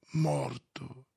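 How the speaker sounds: soft, numb murmur; mechanical whisper. A narrator's reading. Stolz learning his friend is lost, soft and numb. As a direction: say it blankly, almost under your breath. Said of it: "meccanicamente con un sussurro".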